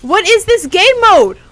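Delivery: high, loud exasperated cry; outraged yell. Real, not acted. Player outraged by the game mode, exasperated yell.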